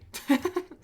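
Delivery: quick high-pitched laugh; short laugh. Real, not acted. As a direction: let out a brief high laugh. Laughs at the upcoming show.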